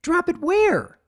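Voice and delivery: high, frantic question; filly panicking over glowing object; panicked. Acted. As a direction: squeak it in panic.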